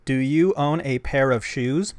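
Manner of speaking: loud, slow question for the translator; clear dictation into phone. Shown as a demonstration. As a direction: ask it slowly and clearly for the app.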